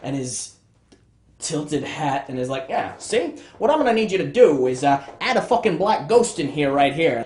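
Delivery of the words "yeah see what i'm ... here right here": put-on fast, loud, high old-time boss voice; R L Stine. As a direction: bark orders like a big shot, see. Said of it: "R L Stine comes in".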